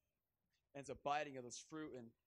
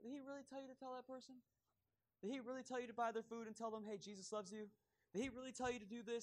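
high, quick, sneering tempter's voice; voice of the enemy; 6 semitones higher than usual. Retold or imitated. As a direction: needle them with doubt, higher and faster. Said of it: "that little voice goes".

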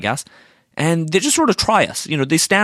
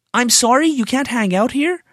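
incredulous mock repeat; 8 semitones higher than usual. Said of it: "what kind of assumptions".

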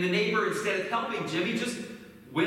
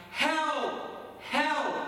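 high, separated calls for help; mock cry for help; 7 semitones higher than usual. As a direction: cry out from the hole without shouting. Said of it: "he heard Jimmy crying out".

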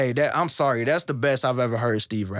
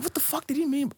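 high, half-swallowed confusion; puzzled disbelief; 13 semitones higher, 4 dB quieter than usual. Retold or imitated. Retelling his confusion over the lyric, high and puzzled. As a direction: act baffled by the lyric. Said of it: "I'm like".

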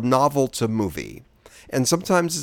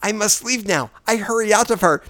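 Mickey Rooney caricature; 7 semitones higher than usual. Acted. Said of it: "I'm doing Mickey Rooney".

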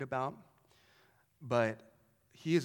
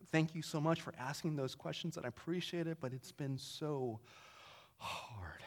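retold tearful; 9 dB quieter than usual. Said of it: "just bawling".

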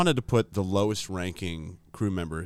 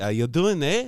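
higher, chirped South African accent; hammed-up South African impression; 6 semitones higher, 4 dB louder than usual. Shown as a demonstration. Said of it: "This is South African".

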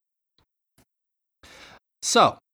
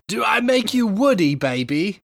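mock Austin Powers; 5 semitones higher than usual. Echoing the parody line.

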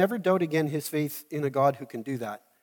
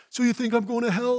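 higher, taunting challenge; mocking; 7 semitones higher than usual. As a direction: needle him with a sneer. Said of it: "a bit of mockery".

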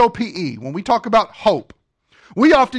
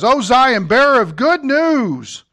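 high, loud, ringing herald's cry; exultant preacher declaiming the verse; scripture proclamation; 6 semitones higher, 5 dB louder than usual. Acted. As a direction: lift the voice and proclaim it to the city. Proclaims scripture at raised pitch.

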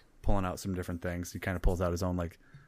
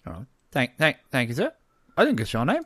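high, loud gnome, stumbling thanks; polite gnome; 7 semitones higher, 7 dB louder than usual. Acted. Gnome thanking the barkeep for food, politely high-pitched. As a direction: thank him in a lifted little voice.

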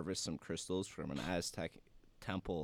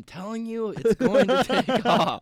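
high, loud hyped-up pitch yelling; screaming into the mic; 14 semitones higher, 11 dB louder than usual. Pretend. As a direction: yell it like a Shark Tank pitch. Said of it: "Stop screaming into the microphone".

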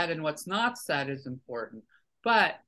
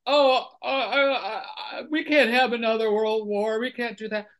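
mock dismay; 5 semitones higher, 3 dB louder than usual. Mimicking people in denial of war.

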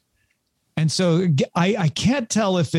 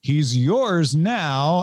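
slow, deliberate; much slower than usual. Retold or imitated.

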